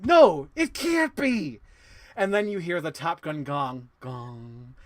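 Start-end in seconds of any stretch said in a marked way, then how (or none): 0.0-1.6 high, loud, slow stunned denial; dramatic disbelief. Retold or imitated. Officer stunned by the tracker news, slow and loud. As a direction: draw out the shocked line.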